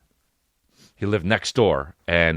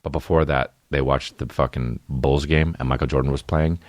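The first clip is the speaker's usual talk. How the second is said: ordinary talk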